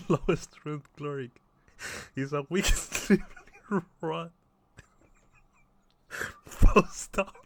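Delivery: high, loud, helpless laughter through the words; laughing while reading. Real, not acted. Player reading cleric description aloud, cracking up. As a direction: try to read on while cracking up.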